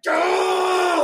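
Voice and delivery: a sudden high, full-throated party scream; wild party yell. Retold or imitated. Mimicking the shirtless hot tub guy's wild yell. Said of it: "he just represents the energy of that".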